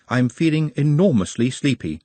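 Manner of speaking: ordinary delivery, nothing marked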